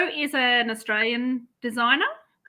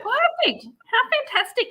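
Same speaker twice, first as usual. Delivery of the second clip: delighted; 13 semitones higher than usual. Real, not acted. Delighted reaction to the chart pocket.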